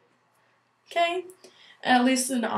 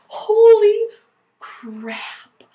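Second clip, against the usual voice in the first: shocked exclaim; 10 semitones higher, 8 dB louder, much slower than usual. Real, not acted. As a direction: shout "holy", whisper "crap". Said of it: "I was freaking out".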